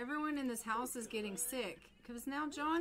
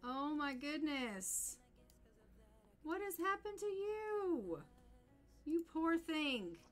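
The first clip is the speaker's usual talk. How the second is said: drawn-out, high-pitched fussing over someone; dismayed pity; 6 semitones higher, slower than usual. Acted. Streamer to an ailing game character, dismayed pity. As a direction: coo in slow, higher-pitched sympathy.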